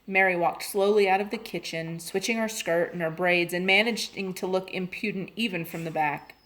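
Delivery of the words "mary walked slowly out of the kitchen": usual speaking voice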